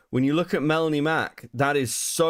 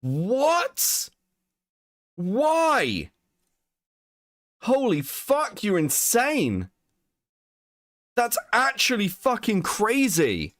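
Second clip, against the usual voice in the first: high, astonished what and why; real shock at the repost; stunned disbelief; 8 semitones higher, slower than usual. Real, not acted. Shocked reaction to reposted video.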